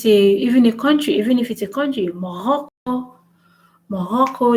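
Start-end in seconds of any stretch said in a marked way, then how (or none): 2.1-4.6 Morocco with a throaty French R; stressed French R. Shown as a demonstration. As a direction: turn the R of Morocco into a back-of-throat rasp. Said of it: "they will stress on it".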